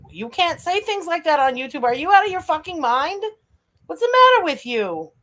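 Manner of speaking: can't believe what you heard; outraged disbelief. Real, not acted. Host scolding Chantal in outraged disbelief. Shocked by clip statement.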